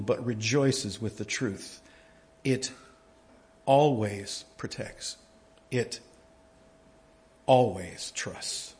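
quiet, slow reading of love never fails; soft scripture reading. Acted. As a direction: let the verses fade into reverence. Scripture reading softens and slows.

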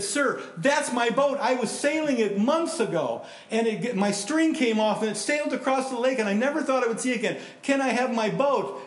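put-on high, pleading little boy voice. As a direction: beg like a hopeful kid. Boy pleads with pawn shop owner.

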